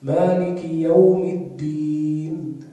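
"الدين" chanted slowly with a true voiced d; loud, drawn-out recitation with a clean dal; clear dal. Shown as a demonstration. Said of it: "لا بد أن يخرج الدال".

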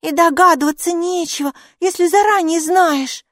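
high, loud retort; high-pitched, sure little creature; cheeky little character. A narrator's reading. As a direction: call back cockily in a small high voice. Maika calls back from below.

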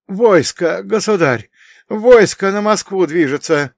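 breathless and high, gasping; wind knocked out. Acted. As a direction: rasp it out, out of breath. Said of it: "задыхаясь, прохрипел он". (задыхаясь, хрипло)

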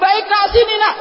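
high, loud crying call; mother calling back. Retold or imitated. Mother calling to her found child, loud high call.

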